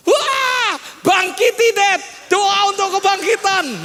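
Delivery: high, loud wail of pleading; mock tearful, high-pitched shouting; imitated crying child. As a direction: wail like a child begging for a miracle. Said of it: "Dia nangis minta bangkiti".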